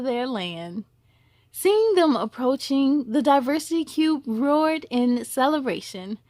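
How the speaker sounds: gentle storybook reading; storyteller voice for children; story narration. A narrator's reading. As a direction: read it like a bedtime story.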